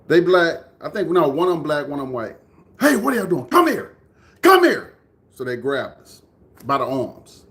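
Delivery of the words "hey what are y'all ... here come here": high, loud imitated command; acted-out shout to come here; retold stern callout. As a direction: order the kids over, getting louder and higher. Retold man calling boys over.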